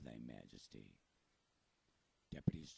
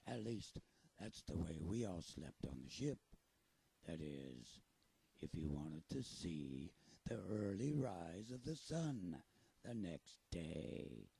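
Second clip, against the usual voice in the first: pirate voice; 4 dB louder than usual. Acted. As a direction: talk like a salty pirate storyteller. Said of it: "Do you think I sound like a pirate?".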